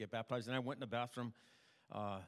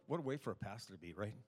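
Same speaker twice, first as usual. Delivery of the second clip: ordinary talk, nothing marked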